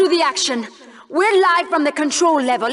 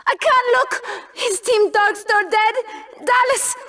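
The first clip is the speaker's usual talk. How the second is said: high, panicked pleading; panicked cry; 8 semitones higher than usual. Acted. Host panicking while watching Team Darkstar fight. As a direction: cry out in panic, unable to look.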